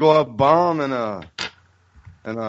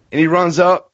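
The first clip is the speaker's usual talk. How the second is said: ordinary talk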